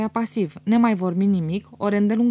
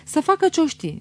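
absurd retort; 8 semitones higher than usual. A narrator's reading. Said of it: "zise el cu absurditate".

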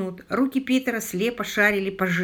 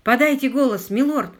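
loud, higher-pitched call; raised, urgent shout; 5 semitones higher, 6 dB louder than usual. A narrator's reading. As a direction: call into the dark for an answer. Calling to unseen man in bog.

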